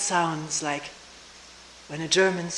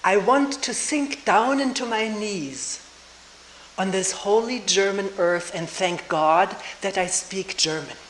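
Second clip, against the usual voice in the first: dramatic recitation; 3 semitones higher, 4 dB louder than usual. Retold or imitated. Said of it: "the way it was put so dramatically and sacredly".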